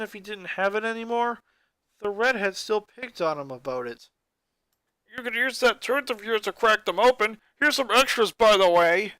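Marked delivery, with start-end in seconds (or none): 5.2-9.1 loud, jeering bully shout; mock him loudly while throwing acorns; shouted taunt. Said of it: "one of the boys shouted".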